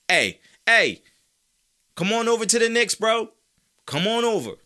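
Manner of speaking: high, loud playful beckoning; excited high-pitched call-over; calling him over. Pretend. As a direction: holler to a buddy to join your side. Calling a player over to Knicks.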